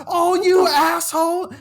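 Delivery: loud, high, stretched mock shriek; outraged older lady. Older lady's outrage retold.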